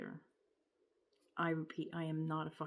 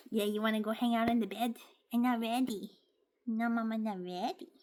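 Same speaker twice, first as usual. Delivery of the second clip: put-on lightly raised baby talk; a hint of cooing to the pet; 5 semitones higher, 4 dB louder than usual.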